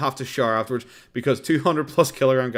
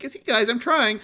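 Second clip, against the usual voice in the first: much higher mock pleading voice; hammed-up excuse; mock Boogie; 12 semitones higher than usual. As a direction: whine it like a fake excuse. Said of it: "so he's like".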